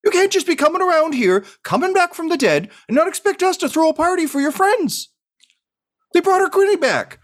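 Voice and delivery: high, teary mother voice, quivering; a tearful mom's very high voice; quivering-lipped mom. Acted. Mom tearfully greets her returned son. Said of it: "with quivering lip".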